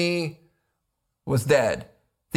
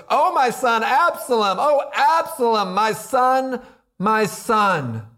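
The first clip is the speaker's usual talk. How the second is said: higher-pitched mournful cry of a king; loud grieving cry; 6 semitones higher, slower than usual. Acted. King David cries for his dead son, loud grieving cry. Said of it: "cried with a loud voice".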